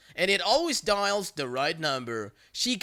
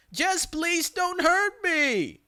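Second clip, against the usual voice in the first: high, whiny fake sobbing plea; hammed-up fake crying to the caller; 11 semitones higher, slower than usual. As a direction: ham up a begging victim. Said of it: "fake crying voice".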